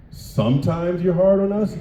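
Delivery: high, loud incredulous squeak; mock disbelief. Kid squeaking back at his tough mother. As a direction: act stunned, voice jumping up. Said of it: "And I was like".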